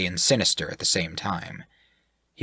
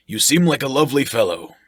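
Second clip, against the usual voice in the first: loud, higher, hearty; jovial sailor-type voice; 6 semitones higher, 5 dB louder than usual. Acted. Shirk flatters the sailor, loud and jovial. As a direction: boom it out like a jolly brute.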